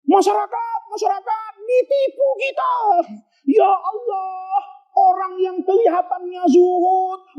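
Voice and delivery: high-pitched tearful outcry to the crowd; villager crying out. Acted. Student rousing the village, high tearful cry. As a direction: wail it to the whole village, scandalized. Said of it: "Teriak dia".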